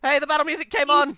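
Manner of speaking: loud high excited exclaim; thrilled the battle music started. Real, not acted. Gamer hearing battle music, loud excited shout.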